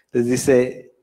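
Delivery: ordinary talk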